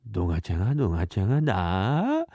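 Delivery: ドガチャガドガチャガ repeated low, rising in disbelief; incredulous master. Acted. Master overhears the clerk's plan, low and rising in disbelief.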